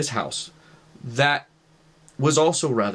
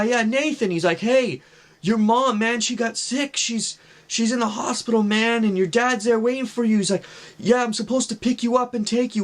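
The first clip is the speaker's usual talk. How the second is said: much higher put-on voice of a slick stranger, urgent; shady stranger; 10 semitones higher than usual. Man in a Firebird urging young Nathan into his car: high, pushy put-on voice. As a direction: imitate the sweet-talking creep pushing the kid to hurry.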